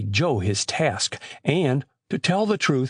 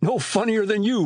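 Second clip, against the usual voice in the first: mild mock roar, pitched higher; roared retort; 8 semitones higher than usual. A narrator's reading. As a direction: fire back with an understated roar, higher. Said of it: "roared Biff".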